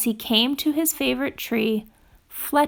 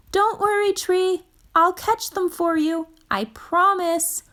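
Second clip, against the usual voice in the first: much higher eager little animal voice; Fletcher's voice; 8 semitones higher than usual. Acted. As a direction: promise it earnestly like a small child. Fletcher promises the tree.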